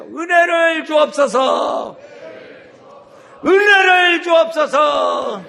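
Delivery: slow, high, loud cry, 은혜를 stressed; shouted prayer. Shown as a demonstration. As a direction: lead the people in crying out. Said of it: "하세요". (다 같이 부르짖는 기도)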